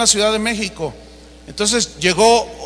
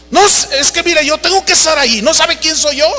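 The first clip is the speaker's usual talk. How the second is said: higher, pushy arrogant plea; arrogant pulling rank; 6 semitones higher than usual. Retold or imitated. Celebrity preacher insisting to the agent, higher and arrogant. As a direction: pull rank with wounded pride.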